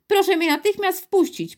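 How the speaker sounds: put-on high-pitched, insistent boy; forceful demand. A narrator's reading. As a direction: demand to be let in at once. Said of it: "powiedział teraz z mocą Piotr".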